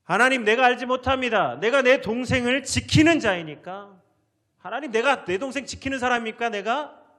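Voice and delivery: loud, high-pitched indignant denial; argumentative protest. Acted. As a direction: argue back at God in protest. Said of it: "이렇게 따지는 거예요".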